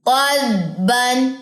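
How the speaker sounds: "क़दबन" drawn out smoothly; correct slow reading. Shown as a demonstration. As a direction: model the right way. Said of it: "ये सही तरीका है".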